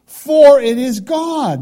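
slow, loud, high stress on "For"; "For" punched loud and slow; emphatic verse. Real, not acted. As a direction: lean hard on "For it is God". Preacher stresses key verse.

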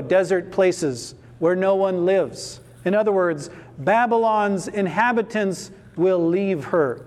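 ordinary delivery

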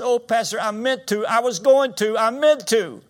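high, loud mock protest; imitated distraught wail. Preacher acts lady pleading with pastor, wailing in imitation. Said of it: "she's sobbing".

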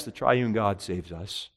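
ordinary talk

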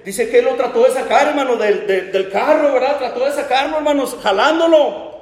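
high, urgent storytelling from the pulpit; impassioned preacher's narration; fervent preaching. Acted. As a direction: preach the struggle with rising urgency. Preaching at full force.

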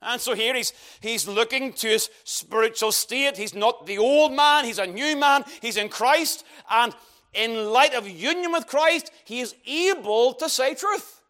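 usual speaking voice

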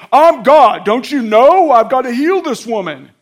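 loud, high, indignant shouting, acted out; hammed-up self-important scolding; self-righteous. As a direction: play at pulling rank, booming. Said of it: "I would have been self-righteous".

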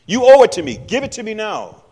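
loud, high, threatening demand for the money; menacing demand. Retold or imitated. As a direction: choke him out for what he's owed, loudly. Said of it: "I'm gonna grab you by the throat and tell you".

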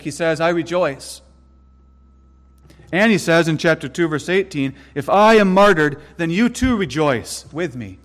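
loud, ringing pulpit reading of scripture; forceful sermon delivery; emphatic preaching. Acted. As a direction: proclaim each verse to the congregation. Preacher reads verses emphatically.

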